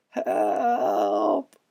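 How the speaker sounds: very high, soft calling ghost; a thin lost voice calling down; called out. Acted. Pipkin the ghost calling down to the boys, thin and high. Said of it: "called Pipkin".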